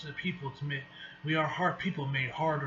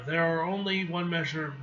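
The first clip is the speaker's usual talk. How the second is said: ordinary delivery, nothing marked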